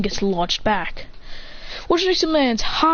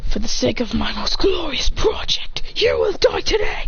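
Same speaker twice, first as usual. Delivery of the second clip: villainous vow. Acted. Fighter vows to kill his rival, villainous vow.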